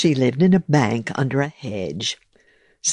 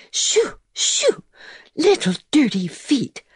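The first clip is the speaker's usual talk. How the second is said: tidy mouse voice; 13 semitones higher than usual. Acted. Mouse housekeeper shoos beetle.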